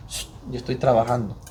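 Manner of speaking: low, soft hush as God; gentle hushing. Acted. God answering a believer who complains, hushed and low. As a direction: hush them quietly, voice dropped.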